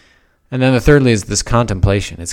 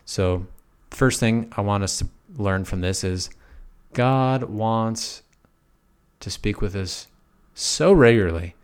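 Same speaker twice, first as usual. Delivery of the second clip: ordinary talk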